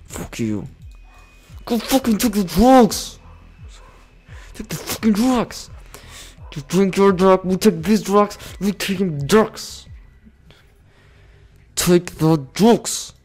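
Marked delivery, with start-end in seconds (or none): 1.6-13.1 loud, high, frantic barking of commands; yelling orders. Real, not acted. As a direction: shout at your units to grab the drugs. Yelling orders at game units.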